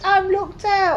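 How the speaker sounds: high, wailing sob-talk; crying. Retold or imitated. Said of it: "why do you say it like I'm crying".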